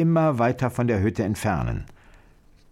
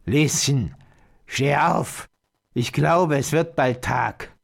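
put-on old witch calling; 3 semitones higher, slower than usual. A narrator's reading. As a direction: call out to wake the girl. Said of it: "Sie rief".

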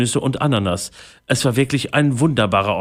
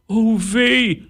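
high-pitched lament; wailing pirate; 12 semitones higher than usual. A narrator's reading. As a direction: whine it well above your usual pitch. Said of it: "jammerte Holzbein". (hoch jammernder Pirat)

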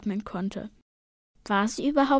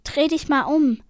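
urgent, louder and higher warning; horrified; 4 semitones higher, 5 dB louder than usual. A narrator's reading. Sister to brother before a giant whirlpool, horrified. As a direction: warn with a touch of alarm. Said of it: "plötzlich entsetzt".

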